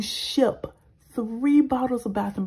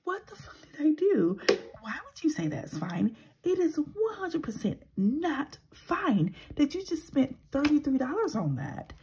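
higher, incredulous tirade at herself; self-scolding; 8 semitones higher than usual. Retold or imitated. Vlogger replaying how she berated herself for overpaying, incredulous. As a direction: scold yourself for being careless. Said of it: "And then I was like".

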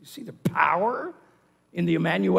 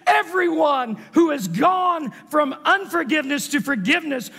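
loud, high, emphatic proclamation; forceful preaching; 6 semitones higher, 4 dB louder than usual. Real, not acted. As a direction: proclaim it to the whole congregation.

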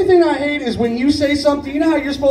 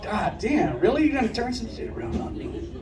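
mild disbelief; 10 dB quieter than usual. Retold or imitated. Retold exasperated reaction.

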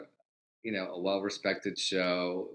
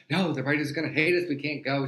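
worried protest; 6 semitones higher, 4 dB louder than usual. Retold or imitated. Nervous protest retold.

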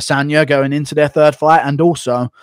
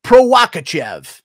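raised, slow mangled name; mock mispronunciation; 5 semitones higher, 6 dB louder, slower than usual. Streamer mocking Chael's mispronounced names, loud and slow. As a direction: botch the name on purpose. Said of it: "Chael pronounces Pereira Piera".

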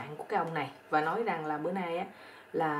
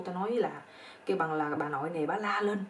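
ordinary delivery

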